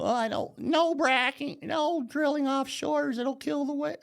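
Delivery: high, whiny voice mocking the people who fear for whales; mocking whiny protester. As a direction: sneer it out like a fussy activist, pitched high.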